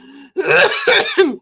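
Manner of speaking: sharp, high coughs; real coughing fit. Real, not acted. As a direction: cough hard after swallowing wrong. Coughing fit, swallowed wrong.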